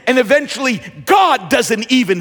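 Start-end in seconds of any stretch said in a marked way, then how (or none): none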